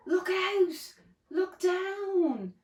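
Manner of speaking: high, drawn-out warning call; mock yell. A narrator's reading. Drip warns his sisters on the bridge, mock yell. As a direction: call out a warning without real volume. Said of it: "who yelled".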